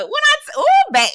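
high, loud burst of excitement; excited squeal. Real, not acted.

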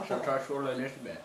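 laughing with a full mouth; words round a mouthful. Real, not acted. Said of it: "It's still in my mouth".

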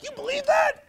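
mocking disbelief. Imitating the man who mocks the preacher's Bible verse, high jeering voice. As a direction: mimic the scoffer's high sneer. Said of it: "He's like".

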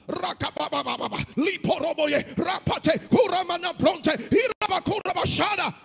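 high, loud babble building to a shout; unstoppable tongues. Retold or imitated. Captive unable to stop praying, tongues building to shouting. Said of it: "I couldn't stop".

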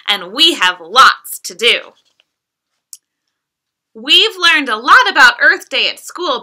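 lively, sing-song picture-book reading; reading to children. Acted. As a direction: read it like bedtime storytime for a toddler. Reads picture book aloud to child.

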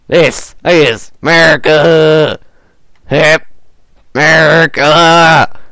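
slow, loud America; rousing chant of America; drawn-out patriotic cry. Acted. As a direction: stretch it out proudly. Patriotic chant while playing.

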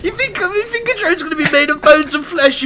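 high, loud, laughing screech; high loud laughing rant; hysterical outraged yell. Real, not acted. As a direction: scream it, half-crying with laughter.

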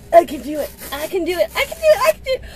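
rising to a high strained pitch; straining determination. Real, not acted. Fighting open the packaging, rising and strained. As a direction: push through the struggle.

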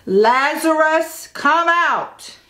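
high, loud commanding call; Jesus calling into the tomb, loud and commanding; mighty yell. Acted. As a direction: roar it out like a lion. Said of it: "he yelled like a lion's mighty roar".

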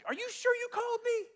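very high, meek little voice; doubtful squeak. Retold or imitated. Gideon asking God if he was called, doubtful. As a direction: ask in a comically unsure squeak.

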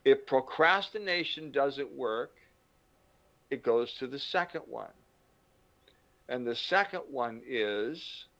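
normal talking voice

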